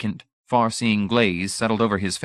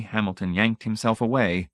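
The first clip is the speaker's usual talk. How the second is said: ordinary delivery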